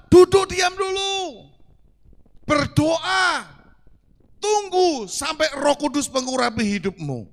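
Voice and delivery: high, loud, slow command; slow the command down and proclaim it; emphatic command. Acted. Preacher proclaiming scripture's command to sit still.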